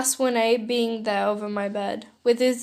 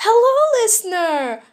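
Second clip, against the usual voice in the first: higher and louder, otherworldly villain greeting; eerie high villain hello; villain Kurogiri voice; 10 semitones higher, 7 dB louder than usual. Acted. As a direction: greet the listener like a looming shadow villain. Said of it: "Kurogiri said".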